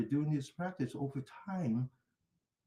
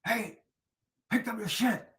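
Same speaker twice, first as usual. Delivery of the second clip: higher, louder imitated neighbour's shout; mock yell; 10 semitones higher, 6 dB louder than usual. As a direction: call out like an angry neighbour across the street. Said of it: "somebody yelled at me".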